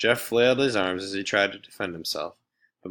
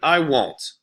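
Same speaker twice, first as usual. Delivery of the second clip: higher, louder burst of anger; angry outburst; 6 semitones higher, 6 dB louder than usual. Acted. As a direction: still shouting at him. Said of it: "Colleen exploded".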